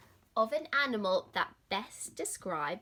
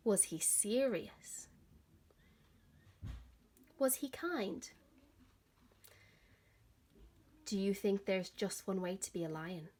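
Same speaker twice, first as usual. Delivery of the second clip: put-on gentle, expressive read-aloud for children; lively picture-book reading; story narration. A narrator's reading. Teacher reads story to children.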